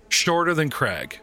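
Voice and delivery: usual speaking voice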